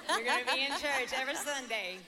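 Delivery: loud, laughing and high, gleefully triumphant; laughing tease. Retold or imitated. Mother gleefully tells her daughter she'll be in church every Sunday, loud and laughing. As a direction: crow the news through a laugh.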